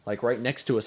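ordinary talk

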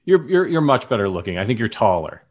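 ordinary talk, nothing marked

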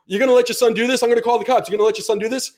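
high, insistent appeal to someone's mother; indignant threat. Retold or imitated. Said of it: "he now looks at my mom".

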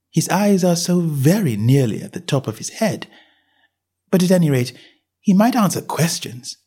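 put-on a well-mannered girl asking; young Alice. A narrator's reading. Alice to herself.

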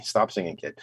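ordinary talk, nothing marked

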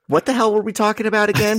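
quick, high, baffled; high-pitched confusion. Real, not acted. Losing track mid-podcast.

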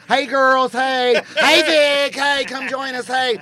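high, loud greetings to a group; cheery calling out. Retold or imitated. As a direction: sing out hellos across the room. Acting out greetings with the women.

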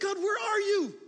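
anguished call, high and loud; desperate cry. Retold or imitated. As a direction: cry out to an absent God. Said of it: "I would think".

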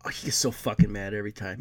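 normal talking voice

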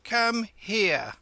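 high, loud, thin summons; thin old voice. A narrator's reading. Old woman behind the curtain summoning Kim, thin voice. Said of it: "said the flat, thin voice behind the curtain".

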